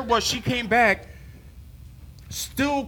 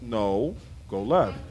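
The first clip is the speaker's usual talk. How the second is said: much lower and softer, patient correction; God's calm answer; 9 semitones lower, 7 dB quieter, much slower than usual. Retold or imitated. Preacher voicing the Lord's reply, low calm correction. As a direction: answer calmly from far below. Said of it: "I clearly hear you".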